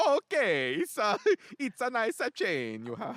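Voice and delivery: high, hammed-up Italian accent; mock Italian accent. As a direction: lay on a thick joke Italian accent. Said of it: "hard Jersey, New York, Italian".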